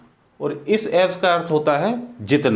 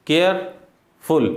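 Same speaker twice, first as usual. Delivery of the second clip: Careful very slow, long gap before फुल; split into syllables; much slower than usual. Shown as a demonstration. Teacher showing more than one syllable, word pulled apart. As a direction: say careful as care, then फुल. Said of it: "जब एक से ज़्यादा syllable".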